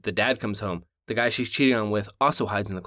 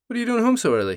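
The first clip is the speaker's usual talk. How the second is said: much higher surprised mom voice; 13 semitones higher, 4 dB louder, faster than usual. Acted. The mom asks her husband why he's home early, high surprised voice. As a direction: squeak it out like a startled mom.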